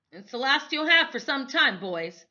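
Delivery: a hint of a gruff trooper; soldier's voice. A narrator's reading. Soldier handing the boys jerky, lightly voiced.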